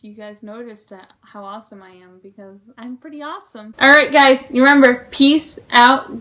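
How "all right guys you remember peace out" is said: much louder, shouting goodbye; big loud peace-out; shouted sign-off. Real, not acted. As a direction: yell the outro to the whole room.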